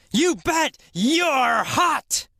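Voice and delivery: high, slow, drawn-out cry; excited exclamation. Acted. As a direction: belt it out with delight, stretching each word. Re-enacts her excited yell.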